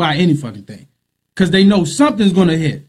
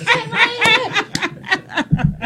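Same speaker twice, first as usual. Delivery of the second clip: very high, squealing imitation of her fuss; hammed-up high-pitched indignation; mock outraged woman; 5 dB quieter than usual. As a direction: imitate her protesting in a comic falsetto. Acting out the woman's outraged reaction.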